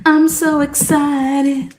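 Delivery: high, slow sing-song gush; drawn-out mock excitement. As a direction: stretch it out like a giddy fan.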